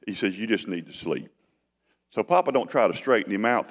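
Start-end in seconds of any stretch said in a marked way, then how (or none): 0.4-1.3 soft and low, kindly; a hint of a gentle father. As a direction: coax him tenderly. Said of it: "He's so gentle with him".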